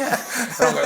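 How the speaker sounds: normal talking voice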